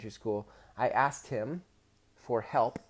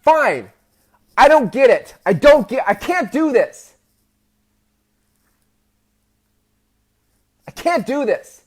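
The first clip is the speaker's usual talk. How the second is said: shouted high and loud, on the edge of tears; tearful outburst; 11 semitones higher, 13 dB louder than usual. Retold or imitated. Boy shoving away from his dad's math help, tearful shout. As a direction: give up in a choked, frustrated yell. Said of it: "I pushed myself away from the table".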